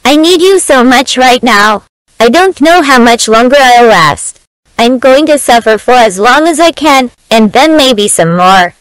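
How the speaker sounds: Jimmy yelling desperately to Sarah for help; desperate shouting. Acted. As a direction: bellow the plea as if in agony.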